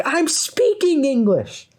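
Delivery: drawn-out, high-pitched mock shout; retold shout back. Customer shouts back when told to speak English, mock yell. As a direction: imitate an exasperated shout, slow and high. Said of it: "And he shouts back".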